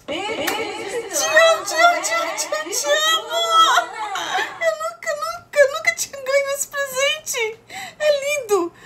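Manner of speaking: high-pitched, drawn-out gushing; thrilled, tearful high voice; overjoyed squealing. Real, not acted. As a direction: squeal with joy, close to tears. Overjoyed at an animated gift.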